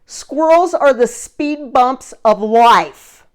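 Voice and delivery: hammed-up driver bellowing a slow parting jab; mock yell. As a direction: shout it out like a parting insult. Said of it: "And he yells at me".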